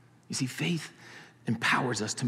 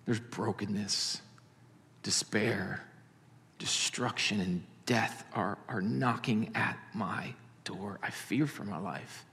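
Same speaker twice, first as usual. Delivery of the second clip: much softer, slow, half-whispered dread; fearful hushed prayer; 3 semitones lower, 6 dB quieter than usual. Acted. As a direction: confess your fear to God in a broken hush. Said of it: "you hear the fear".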